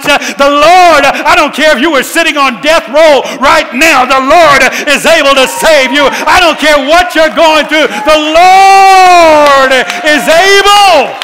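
loud, rising preacher's cry; full-force preaching. Acted. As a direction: drive it home to the congregation.

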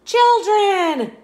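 high, loud wail of disbelief; a little T-Rex shrieking in surprise; shocked cry. Acted. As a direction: cry the word out in horror. Penelope shocked at classmates.

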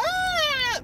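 high, loud mock kid wail; childish wail. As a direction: wail like a hurt toddler. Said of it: "if I got a boo-boo".

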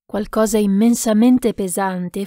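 usual speaking voice

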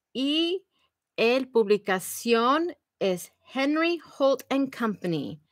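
normal talking voice

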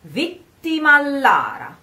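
vittimallara run together, double L held; linked double L. Shown as a demonstration. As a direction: slide vittima into Lara with a long L.

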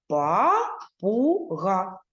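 папуга split into slow even syllables. Shown as a demonstration. Teacher counting syllables with pupils, slow chant. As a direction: spell папуга out pa-pu-ga, very slowly. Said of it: "визначаємо кількість складів".

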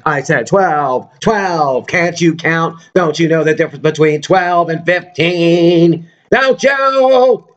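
high, slow, nagging scold; mock scolding. Imitating the annoyed coworker scolding him over the price, mock angry. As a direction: nag him about the price, mock outrage. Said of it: "she said".

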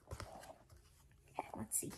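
soft breathy whisper to herself. Real, not acted. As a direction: whisper it under your breath. Whispers title, then to herself.

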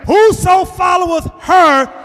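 high, slow shout on Whoso followeth her; shouted emphasis. Shown as a demonstration. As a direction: bellow each word slowly to drive it home. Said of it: "Let, wait".

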